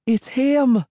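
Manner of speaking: high, scared cry of recognition; a girl crying out in fright at the hermit; frightened cry. A narrator's reading. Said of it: "Cassie cried".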